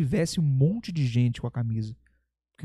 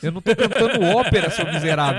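mock exasperation; 3 semitones higher, 8 dB louder than usual. Acts out his exasperated retort to critic.

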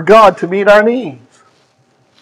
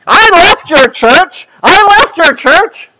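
wag a finger and scold her; scolding shout; 7 semitones higher, slower than usual. Retold or imitated. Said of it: "wagged her finger and said".

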